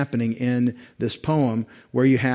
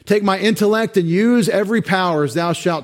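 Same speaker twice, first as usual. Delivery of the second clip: higher and louder, pressing the hymn words; fervent preaching; 7 semitones higher, 6 dB louder than usual. Acted. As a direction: preach it out, urging them to commit.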